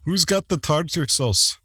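badly faked English accent that comes out Scottish. Host joking as a British wrestler, badly faked English accent. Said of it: "I cannot do an English accent. It's all Scottish".

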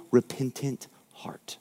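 drops to a hushed whisper. Real, not acted. Preacher closing his point to the congregation: soft whisper. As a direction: breathe the last words, barely voiced.

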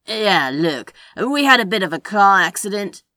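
loud, pressing character voice; Crowley explaining. A narrator's reading. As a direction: push in firmly to explain. Crowley starts explaining, gets cut off.

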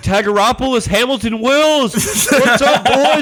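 a hint of a tanked teacher hailing old students; tipsy teacher greeting. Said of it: "He's like".

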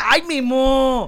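loud, slow drawn-out mock shock; mock scandalized. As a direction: drag it out scandalized.

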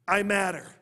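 shouted call for the crowd; call and response. Shown as a demonstration. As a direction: lead the crowd, loud and strong. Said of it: "Everybody say this with me".

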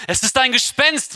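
high-pitched mild cry of fear; frightened cry. A narrator's reading. Disciples seeing a figure on the water, frightened. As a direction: read it as a startled, fearful exclamation. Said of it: "riefen sie vor Angst".

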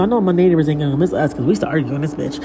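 usual speaking voice

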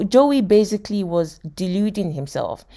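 ordinary talk

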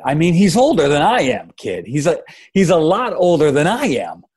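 loud, high-pitched father's protest; worked-up, disbelieving dad; appalled outburst. Acted. Father shocked at fiance's age.

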